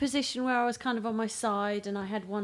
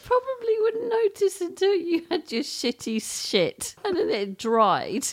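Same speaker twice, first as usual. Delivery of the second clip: laughing; 10 semitones higher than usual. Real, not acted. Laughing while talking.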